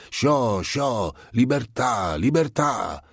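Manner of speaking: high, excited shooing cries; crying out. A narrator's reading. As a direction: keep calling out, pitched high. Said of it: "seguitando a gridare per le stanze ormai vuote". (grida acute ed esultanti)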